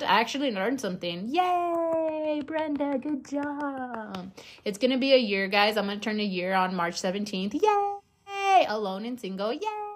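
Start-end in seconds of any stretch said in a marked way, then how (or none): 1.3-4.3 mock self-cheer. Cheering herself mockingly after learning. 7.5-8.7 high, squealed little hurray; mock cheer. Cheers her own anniversary. 9.5-9.9 small, very high, softer yay; sarcastic cheer. Pretend. Sarcastic cheer at being single.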